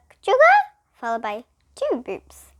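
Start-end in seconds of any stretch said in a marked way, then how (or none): 0.1-0.5 high, loud "Ka-chugga," rising; bell sound. A narrator's reading. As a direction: ring out "Ka-chugga," like an odd bell. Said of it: "There came another".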